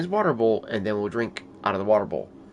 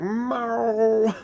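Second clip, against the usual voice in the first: much higher mock cat meow; imitated demanding meow; 11 semitones higher, 4 dB louder than usual. Owner imitates the cat meowing for water, high. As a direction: squeal a needy meow. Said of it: "he'll start doing this whole, like".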